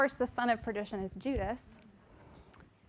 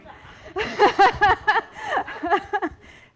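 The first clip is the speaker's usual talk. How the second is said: big burst of laughter, high and loud; embarrassed laugh breaking the lesson; loud real laugh; 11 semitones higher, 12 dB louder than usual. Real, not acted. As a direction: crack up at yourself. Laughing at herself mid-lesson.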